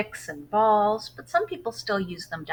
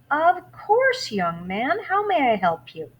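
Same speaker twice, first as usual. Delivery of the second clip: kind librarian; 8 semitones higher, 7 dB louder than usual. Acted. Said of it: "She said".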